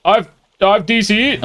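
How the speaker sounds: high, whiny mock of a Twitter user; mocking whiny gamer. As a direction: sneer it like a sore loser crying lag. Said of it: "and they're saying".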